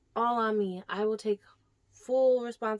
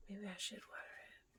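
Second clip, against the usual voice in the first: soft voiceless musing; quiet whispered aside; 2 semitones lower, 22 dB quieter than usual. Real, not acted. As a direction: think it over under your breath. Whispered musing beside the plant.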